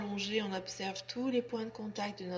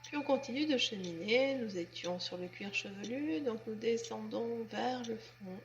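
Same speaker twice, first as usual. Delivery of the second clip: guided relaxation; 5 dB quieter than usual. Acted. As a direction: guide them gently and unhurriedly into letting go. (voix douce et lente de relaxation guidée)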